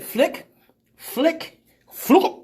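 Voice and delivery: rising mock flicks. Mimicking the ice cream flick.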